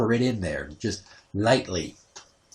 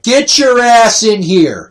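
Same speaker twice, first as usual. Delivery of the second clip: slow, loud hammed-up shout, much higher; mock shout; 10 semitones higher, 10 dB louder, slower than usual. Calling his ex from the laundry, slow mock shout. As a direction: bellow it across the house. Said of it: "I'm like".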